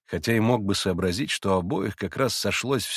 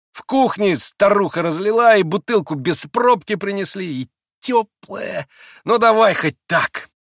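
higher, louder sneering man; mocking Rakitin; 10 semitones higher, 6 dB louder than usual. A narrator's reading. Rakitin taunts Grushenka, sneering and loud. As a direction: tease her with contempt. Rakitin complains about champagne.

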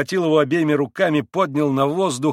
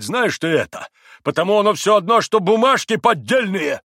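high, raised quarrelling voice; heated, high-pitched tirade; angry accusation; 8 semitones higher, 5 dB louder than usual. A narrator's reading. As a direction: hurl the accusations back.

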